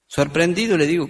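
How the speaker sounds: usual speaking voice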